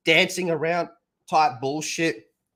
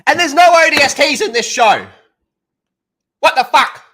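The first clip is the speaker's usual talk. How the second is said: outraged outburst; 10 semitones higher, 10 dB louder than usual. Real, not acted. Outburst about missing ODST.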